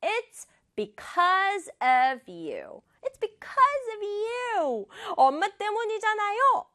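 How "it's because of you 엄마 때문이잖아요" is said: high-pitched accusing outburst; acted angry yell. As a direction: hurl blame in a mock child's yell. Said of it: "이렇게 소리를 질렀어요".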